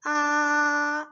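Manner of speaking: high level 아 held steady; first tone 아. Shown as a demonstration. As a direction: hold 아 high and flat like a Chinese first tone. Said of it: "미에서 솔까지 올라가는 거예요". (높고 평평한 1성 아)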